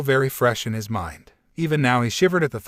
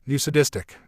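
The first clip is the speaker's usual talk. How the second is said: plain reading voice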